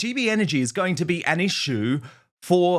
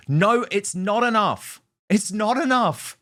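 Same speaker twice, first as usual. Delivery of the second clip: higher, slow, emphatic protest; slow, pitched-up insistence; exasperated rebuttal; 7 semitones higher, slower than usual. Real, not acted. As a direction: hammer each word out in exasperation. Angrily rebutting the imagined strategy.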